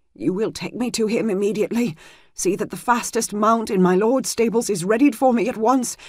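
urgent command. Acted. Healer gives urgent orders.

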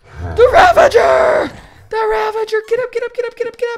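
high, full-volume yell; real high-pitched shouting in the heat of the game; excited shouting. Real, not acted. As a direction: scream it out in frantic urgency. Yelling as Ravager is spawned.

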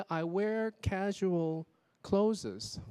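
CLOTHES as two syllables, "clo-zes", very slow; drawn-out "clothes" with an added -es; extra syllable. Retold or imitated. Teacher quoting a student's error, "clothes" as clo-zes. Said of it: "我听到一个".

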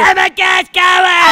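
high, loud shocked exclamation; alarmed cry. Acted. Friend reacting to a broken hand, alarmed cry.

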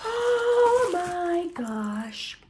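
slow drawn-out oh my gosh; awed. Real, not acted. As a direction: stretch it out in awe. Awed by the gift.

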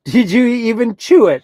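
loud amazed question to the gulping dog; amazed shout. Real, not acted. Dog gulps treat.

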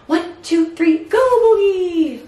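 high, sing-song voice for the bird; pet talk. Real, not acted. Talking to her pet bird.

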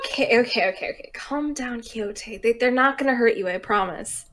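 soft, calming reassurance; calm a frightened creature softly; hushed, gentle coaxing; gentle soothing. Acted. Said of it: "gentler nature seems to temporarily soothe".

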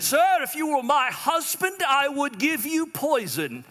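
high, slow mock posh British lady; British accent. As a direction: play the offended English lady, slow and high. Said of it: "How's my accent".

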